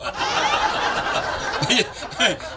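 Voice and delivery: breathy, unvoiced laugh at the punchline. Real, not acted.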